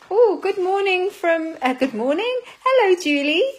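high, loud, delighted hello to a viewer; bright greeting. Real, not acted.

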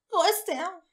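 high-pitched, alarmed question; high panicked voice. A narrator's reading. Mother waking to look for her baby, panicked. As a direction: cry it out in sudden panic. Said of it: "fragte eine hohe panische Stimme".

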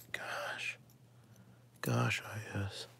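breathy whispered gosh of sudden recall; whispered to self. Real, not acted. Muttering to herself as she finally remembers, whispered. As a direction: whisper it under your breath as the answer comes.

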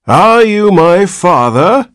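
put-on high, slow old man's demand; cracked voice of an ancient man. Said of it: "in a cracked and ancient voice".

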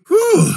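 high loud whew of relief; close-call exhale; relieved whew. Acted.

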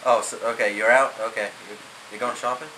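usual speaking voice

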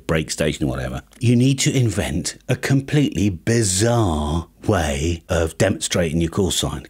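ordinary talk, nothing marked

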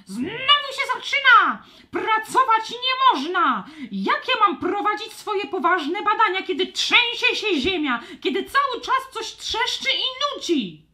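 very high, loud squawky complaint; cranky bird scientist. Acted. Stormbird scientist angrily complaining about the dragons' noise. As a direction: screech it out as an irritated old bird.

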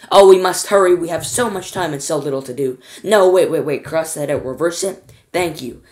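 loud, hurried showman's outburst; flustered, exclaiming loudly; excited cry. A narrator's reading. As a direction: burst out, bubbling over with urgency. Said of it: "he cried, clapping his hands once".

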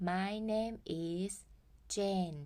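My name is Jane drawn out slowly; teacher modelling slowly; slow model sentence. Shown as a demonstration. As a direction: spell it out slowly for learners. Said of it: "ให้พูดว่า".

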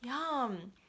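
plain reading voice

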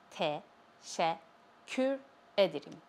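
slow "Teşekkür", split as te, şek, kür, with clear pauses; syllable by syllable. Shown as a demonstration. Teacher showing learners how to say "Teşekkür ederim", slowly syllable by syllable. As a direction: break "Teşekkür" into separate syllables, then say "ederim".

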